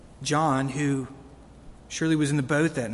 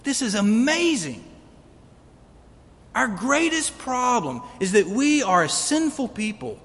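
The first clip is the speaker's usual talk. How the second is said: loud, ringing scripture reading; booming pulpit voice for the verse; full-force preaching; 3 semitones higher, 4 dB louder than usual. Acted. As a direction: proclaim it to the whole church. Preaching climax.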